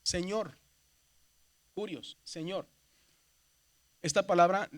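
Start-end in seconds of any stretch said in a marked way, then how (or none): none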